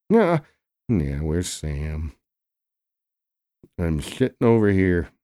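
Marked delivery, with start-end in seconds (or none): 0.1-0.4 squeaky yelp. Acted. Sudden high yelp.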